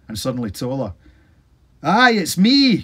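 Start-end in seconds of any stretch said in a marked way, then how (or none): 1.8-2.8 loud, high, very slow far-off call; John answers from the fog, muffled and distant. A narrator's reading. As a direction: call back from far away. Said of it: "came a muffled voice".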